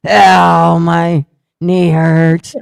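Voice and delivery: slow, loud whiny groan of pain; hammed-up pained whine over the toilet; mock whimper. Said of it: "while I was going".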